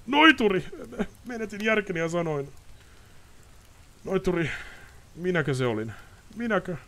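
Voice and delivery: high, anguished nobleman begging; desperate pleading. Acted. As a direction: plead for an answer. Ostrid desperate.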